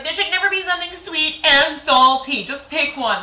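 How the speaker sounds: high, loud mock indignation; picky boyfriend's complaint. Boyfriend objecting to sweet and salty food, mock indignant. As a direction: protest loudly, pitched well up. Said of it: "he's like".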